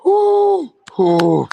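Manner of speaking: high breathy ooh then a huff; mock huffing. Boxing fan mimics Usyk blowing on the ropes, mock gasps. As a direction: imitate a fighter gasping for air. Mocking Usyk's heavy breathing.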